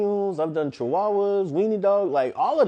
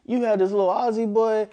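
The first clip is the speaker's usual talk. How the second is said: ordinary delivery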